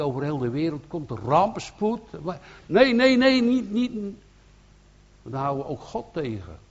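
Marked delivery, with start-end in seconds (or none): 2.7-3.9 loud, high repeated no; rising burst of protest; emphatic no. Real, not acted. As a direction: cry out no, no, firmly refusing. Preacher rejects holding God back.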